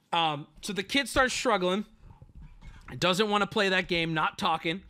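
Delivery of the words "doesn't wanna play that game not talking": ordinary talk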